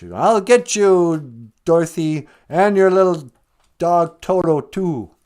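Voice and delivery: loud, high mock witch threat; drawn-out hammed-up witch menace; threatening witch. Living witch threatening Dorothy and her little dog, high witch voice.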